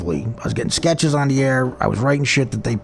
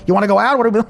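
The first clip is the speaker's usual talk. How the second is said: higher-pitched mock Newark accent; 7 semitones higher, much faster than usual. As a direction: slip into Jersey talk from Newark. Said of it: "I sound like I'm from Newark".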